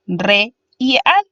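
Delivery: RE and AL drawn out slowly, held apart; syllable by syllable. Shown as a demonstration. Teacher dividing real for students, syllable by syllable. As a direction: separate "re" from "al" with a pause. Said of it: "se divide en dos sílabas".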